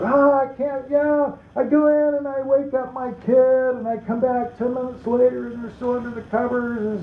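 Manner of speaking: high, frustrated complaint from a mother; a mother complains her kid won't get up; exasperated mom. Retold or imitated. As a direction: vent like a worn-out mom. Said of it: "where she said".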